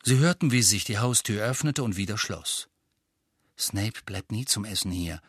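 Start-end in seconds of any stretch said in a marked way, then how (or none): 3.6-5.2 softly, low and hushed; quiet confiding murmur. A narrator's reading. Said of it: "mit leiser Stimme".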